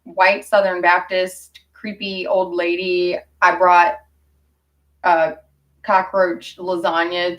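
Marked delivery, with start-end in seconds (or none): none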